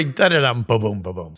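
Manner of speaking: playful mouth drum fill after a pun; drum beat mimicry. Host mimicking a drummer's rimshot after a pun, playful mouth drumming. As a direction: tap out a rimshot with your voice.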